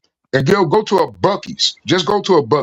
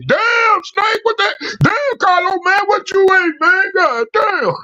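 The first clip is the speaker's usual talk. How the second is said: much higher, amused yelling; mock shout from strangers; 12 semitones higher, slower than usual. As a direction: yell it like the guys at the gas pump heard it. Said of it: "They were like".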